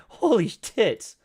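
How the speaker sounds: can't believe the chart, laughing; a real burst of disbelief; shocked laugh. Real, not acted. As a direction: laugh out loud, then a slow, high, stunned exclamation.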